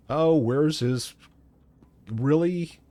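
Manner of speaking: usual speaking voice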